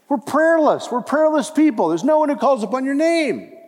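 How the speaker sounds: high, loud, impassioned preaching; full-force preaching. Acted. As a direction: press the point home with fire.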